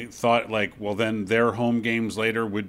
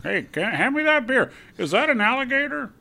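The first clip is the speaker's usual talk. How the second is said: high, dopey local guy; clueless Florida fan voice; Saint Petersburg voice; 10 semitones higher than usual. Acted. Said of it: "It's a Saint Petersburg, uh, uh, voice".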